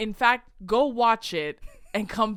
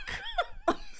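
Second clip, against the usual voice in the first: laughing; 5 dB quieter than usual. Real, not acted. Laughing after punchline.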